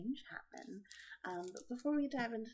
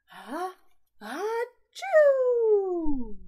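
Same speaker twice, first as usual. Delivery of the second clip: building sneeze ending in a very loud, high burst; big bear sneeze; 11 semitones higher, 19 dB louder, much slower than usual. Acted. Bear woken by pepper, huge building sneeze. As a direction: wind up and blast the sneeze.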